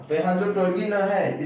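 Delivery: normal talking voice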